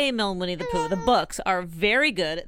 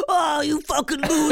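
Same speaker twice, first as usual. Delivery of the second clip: higher mocking shout of a bully; mock taunt; 7 semitones higher than usual. [name] playing Jackie taunting her husband, a mock bully shout. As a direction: jeer at him like a schoolyard bully. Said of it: "you just be like".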